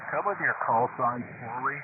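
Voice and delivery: normal talking voice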